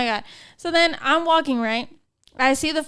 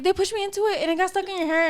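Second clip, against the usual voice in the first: high, soft, hurried explanation; quick pitched-up apologetic voice; flustered apologizer; 5 semitones higher, 5 dB quieter, faster than usual. Retold or imitated. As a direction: imitate a stranger rushing out an excuse.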